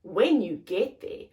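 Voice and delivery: plain reading voice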